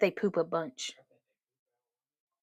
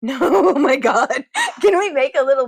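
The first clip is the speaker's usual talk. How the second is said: laughing; 4 semitones higher, 8 dB louder than usual. Real, not acted. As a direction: laugh through it loudly. Laughing, slow and loud.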